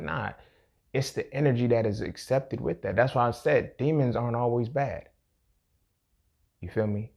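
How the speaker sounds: ordinary delivery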